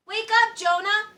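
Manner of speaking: high, loud urgent yell; frantic yell; yelling to wake him. Acted. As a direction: shake him awake with your voice. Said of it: "yelled the sailors".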